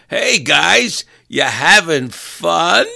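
high, loud, drawn-out sarcastic greeting; mock cheery hello. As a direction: sing it out like a chipper host. Mock-friendly call to crashed drivers.

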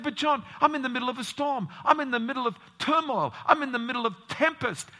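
high, loud, fast pleading; panicked plea. Retold or imitated. A worried believer pleading with Pastor John, high and fast. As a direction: cry out like a man caught in a storm. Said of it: "He said".

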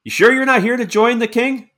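high probing question; suspicious. Acted. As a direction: doubt every word he said. Soldier doubts stranger's claim.

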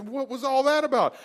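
high-pitched, acted bewildered question; retold baffled demand. Baffled runner questions his dad afterward.

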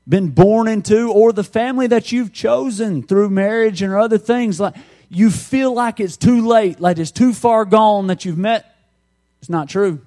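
impassioned preaching. Real, not acted. Preacher urging those who feel it's too late, impassioned. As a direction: press the point home with force. Preacher building to full force.

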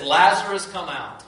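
retold commanding call, loudest on the name. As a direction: call out the name loudly, then ease off. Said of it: "it says in a loud voice".

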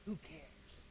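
very soft, low brush-off; dismissive mutter. Acted. Answering somebody's "I love you", dismissive mutter.

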